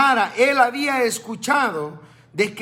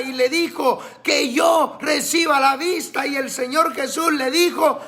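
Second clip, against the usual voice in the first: higher, shouting sermon delivery; fiery preaching; 7 semitones higher than usual. Acted. Pastor exhorting his brothers in faith, fiery higher shout. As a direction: preach it with fire, calling out to the faithful.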